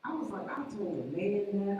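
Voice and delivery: ordinary delivery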